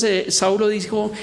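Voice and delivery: ordinary talk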